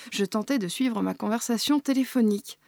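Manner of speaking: usual speaking voice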